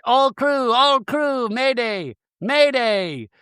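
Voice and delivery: high-pitched mayday call; Captain Jason. Retold or imitated. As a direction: announce an emergency to all crew, phrase by phrase. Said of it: "Captain Jason's like".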